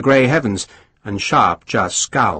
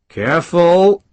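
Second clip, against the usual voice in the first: growled; 6 semitones higher, 3 dB louder, slower than usual. A narrator's reading. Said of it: "Sharp growled".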